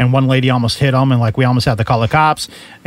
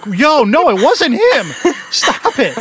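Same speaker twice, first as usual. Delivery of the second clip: a far higher, slowed, half-laughing plea to stop; mock frantic pleading; 11 semitones higher, much slower than usual. Friends pulling Shane off the wrong guy, high mock plea. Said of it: "and is like".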